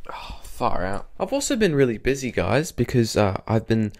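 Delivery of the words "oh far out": whispered aside. Real, not acted. Aside to himself.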